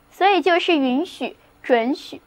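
ordinary talk

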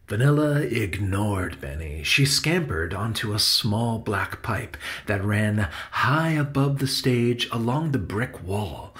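usual speaking voice

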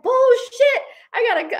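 very high, loud mock outburst; retold yelling. Husband yelling at the tourist's wife, mock-angry. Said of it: "just yelling at her".

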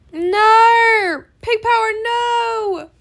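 high, loud hammed-up wail; dramatic shouted farewell protest; mock despairing cry. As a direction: cry out in pretend anguish. Mock despair as viewer leaves.

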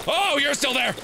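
high, sudden exclamation; startled alarm. Real, not acted. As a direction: cry out at finding it still there. Monster reappears.

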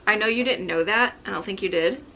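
ordinary talk

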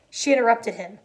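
ordinary delivery

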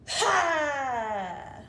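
loud forceful exhale, a big breathy ha; loud lion breath. Shown as a demonstration. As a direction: push all the air out in one loud ha. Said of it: "a lion's breath isn't screaming".

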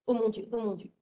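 ordinary delivery

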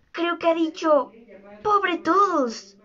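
ordinary delivery, nothing marked

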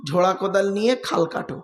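ordinary delivery

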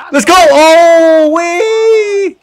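loud yell soaring into a high squeal; ecstatic cheer. Real, not acted. Gamer celebrating his goal, ecstatic cheer.

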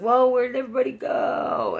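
put-on high, loud, slurry drunk call; calling out lost and wasted; drunk yell. Drunk me at the bar calling for friends, high loud yell.

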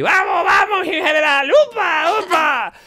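put-on loud high drunk cheering on horse; drunk shouting. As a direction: yell like a drunk jockey. Drunk urging the general on.

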